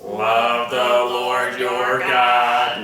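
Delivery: very slow, mechanical alien drone; robot alien voice. Pretend. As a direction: chant it like a robot from space. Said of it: "how about we do it in a robot alien voice".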